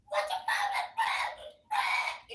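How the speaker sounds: very high, slow, shrieked mock mom; stretched shrieking what the fuck; mom freaking out. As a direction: screech it like the panicking mom. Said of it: "My mom, she's like".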